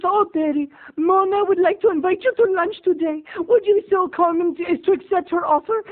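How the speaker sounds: hammed-up prim mom, high and loud; mother's invitation. Neighbor mom inviting Terry to lunch, high and loud. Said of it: "She says".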